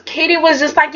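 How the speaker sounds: ordinary talk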